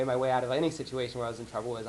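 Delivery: ordinary talk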